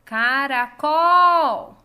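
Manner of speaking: "Caracol" called very loud, final COL long; calling out the word. Shown as a demonstration. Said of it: "esse exercício de chamar as palavras".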